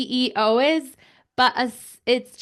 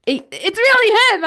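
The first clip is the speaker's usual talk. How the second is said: amazed excitement; 10 semitones higher, 8 dB louder than usual. Retold or imitated. Acting out amazed recognition from photo.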